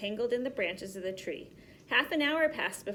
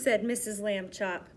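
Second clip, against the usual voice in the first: usual speaking voice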